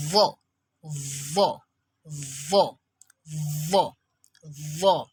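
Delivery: "vo" opening on a long buzz between tongue tip and teeth; tongue-tip consonant. Shown as a demonstration. Teacher drilling the letter, buzzed tongue-tip "vo". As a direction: hold the first sound, then release "vo". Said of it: "its sound is produced between the very tip of".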